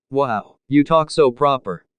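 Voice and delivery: ordinary delivery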